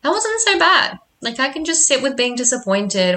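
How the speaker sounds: high-pitched, pleased inner voice; high little voice of self-congratulation; proud self-talk. Retold or imitated. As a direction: quote yourself brightly relieved, pitched well up. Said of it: "I'm really proud of myself".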